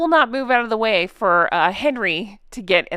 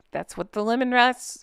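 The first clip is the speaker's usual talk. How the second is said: ordinary delivery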